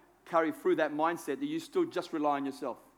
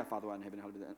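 much softer, words run together; mumbled rote prayer; 11 semitones lower, 15 dB quieter, much faster than usual. Retold or imitated. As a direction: mutter it like empty repetition. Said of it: "Um, repetitive words".

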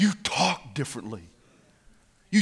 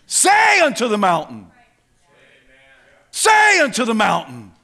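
loud, raised preaching command; commanding shout; 7 semitones higher, 8 dB louder than usual. Real, not acted. Preacher urging believers to face the mountain, loud command. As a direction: hit it hard, then let it fall.

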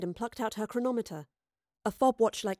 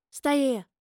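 firm, louder command; order; 5 semitones higher, 5 dB louder than usual. A narrator's reading. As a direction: tell him to stay put. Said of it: "Astrid ordered".

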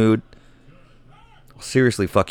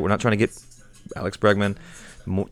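ordinary talk

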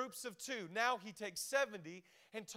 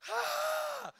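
mock hype yell. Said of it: "I'm just like".

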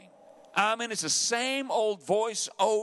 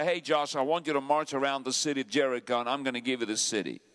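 lower, fast, offhand voice of God; God speaking; 8 semitones lower, faster than usual. Pretend. God telling Joshua to march around Jericho, low and brisk. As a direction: toss off the command like it's simple.

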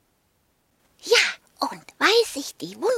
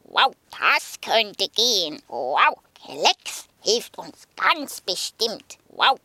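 low woofing dog; 4 semitones lower than usual. Acted. Sokrates speaks again.

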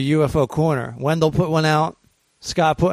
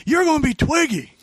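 high-pitched drill-instructor nickname call; mock drill sergeant; 11 semitones higher than usual. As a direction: hand out a nickname like a movie sergeant. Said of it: "like in Full Metal Jacket".

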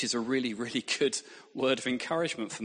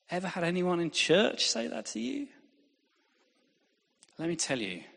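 ordinary delivery

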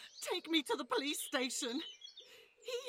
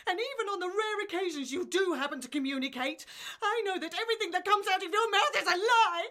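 fast, louder accusing rant; angry outburst; 3 semitones higher, 3 dB louder, faster than usual. Acted. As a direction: pour it out at speed. Wife's outburst at husband.

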